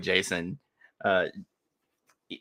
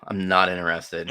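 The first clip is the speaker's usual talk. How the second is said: usual speaking voice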